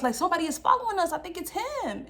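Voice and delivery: high, hurried imitation of panic; mock alarmed sister. Voices sister alarmed at being followed.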